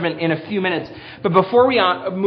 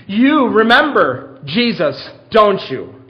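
raised, louder, slow pointed challenge; Peter preaching; 3 semitones higher, 5 dB louder, slower than usual. Acted. Peter confronting the Jerusalem crowd, insistent preaching. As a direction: press the crowd to remember, stretching the words. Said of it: "What could he have said?".

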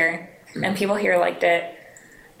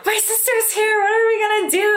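high, panicky retelling of her own freakout; replaying her own pre-show meltdown, high and panicky; mock panic; 3 dB louder than usual. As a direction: squeal it like you're losing it before the show. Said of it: "And I was just like".

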